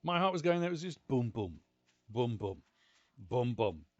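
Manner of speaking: normal talking voice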